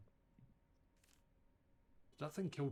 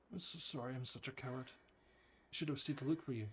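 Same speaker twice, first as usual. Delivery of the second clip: ordinary talk, nothing marked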